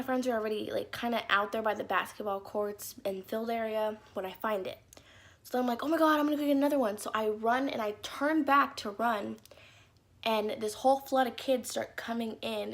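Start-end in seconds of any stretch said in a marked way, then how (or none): 5.8-7.0 fast, loud, high rush of glee; excited. Retold or imitated. Kid spotting a free popsicle on the field, fast thrilled blurt.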